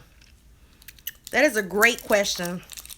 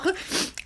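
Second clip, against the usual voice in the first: breathy silent laugh; 8 semitones higher, 8 dB quieter than usual. Real, not acted. Laughing about choking.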